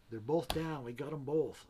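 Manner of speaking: calling up the hill, loud and high; retold yell. Nephew shouting up to the hunter that both deer are down, loud yell. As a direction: yell the news uphill to him. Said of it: "he was yelling up at me".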